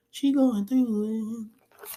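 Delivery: high, drawn-out comic lament; mock wailing. Mock wail for grieving mother.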